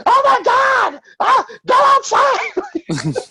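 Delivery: high shrieking panic; a startled woman shrieking at two men fighting; retold scream. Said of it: "she screamed".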